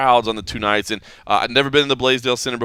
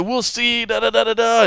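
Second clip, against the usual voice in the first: mimicked heckling, pitched up; retold yelling; 10 semitones higher than usual. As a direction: imitate a coach yelling across the room. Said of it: "The coach was yelling at each other".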